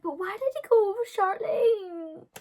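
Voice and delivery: high, loud, tearful whine; whiny teen. Retold or imitated. Teen asks Paula through tears, high and whining. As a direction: cry it out like a jilted teen. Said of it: "I'm like".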